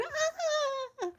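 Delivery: high mock children's scream; imitating children's high-pitched screams; retold kids screaming. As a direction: shriek like scared kids in a line. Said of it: "the kids screaming".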